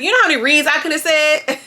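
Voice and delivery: high, punchy repeat of Bobby's line. Retold or imitated. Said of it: "That's my new thing".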